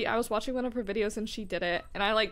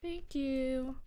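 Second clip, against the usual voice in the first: cutesy thanks; 7 semitones higher, 4 dB quieter, much slower than usual. Pretend. Cutesy thanks during game.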